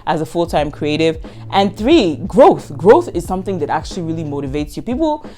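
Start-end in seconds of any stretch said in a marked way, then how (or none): 4.8-5.2 high-pitched call for attention. Real, not acted. Creator calling out to viewers, high-pitched call. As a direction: pitch way up to grab them.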